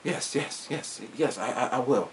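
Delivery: hushed, stammering obedience; whispery pretend compliance; mock meek minion. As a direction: agree softly like a servile henchman. Playing obedient team member replying.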